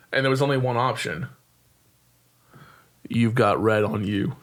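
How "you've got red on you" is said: impression. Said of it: "I'm gonna do my best impersonation".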